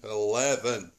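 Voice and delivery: punched mock-Scottish word, falling at the end; Scottish accent. Dad quoting the Burniston lift clip, Scottish accent. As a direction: say it as a Scot to a voice lift. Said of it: "it's like the old clip from Burniston".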